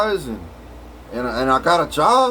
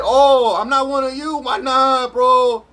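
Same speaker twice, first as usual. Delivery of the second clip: pleading self; 8 semitones higher than usual. Retold or imitated. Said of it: "I said".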